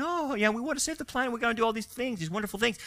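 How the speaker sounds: high, soft, sugary mockery of planet savers; sarcastic mock globalist. Preacher mocking globalists to his audience, high soft sarcasm.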